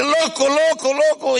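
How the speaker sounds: high, urgent "loco" yelled over and over; frantic warning shout. Retold or imitated. Driver at the curve yelling at the other car, high urgent shout.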